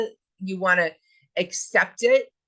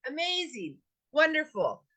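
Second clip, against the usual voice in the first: higher, bright praise of the clean car; delighted; 7 semitones higher, 4 dB quieter than usual. Real, not acted. Teacher describes coming out of the car wash, delighted. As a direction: gush over it, sincerely thrilled.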